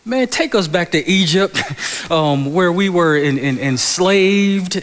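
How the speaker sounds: high, loud whiny mock complaint, laughing; mock complaining Israelites. Pastor mocking the Israelites to Moses, hammed-up whine. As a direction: ham up the grumbling crowd begging for Egypt. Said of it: "looked at Moses and said".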